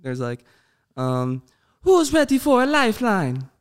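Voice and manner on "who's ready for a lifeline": much higher, perky game medic callout; chirpy battle-ready quip; Lifeline impression. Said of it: "like, in Apex".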